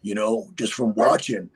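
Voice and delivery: usual speaking voice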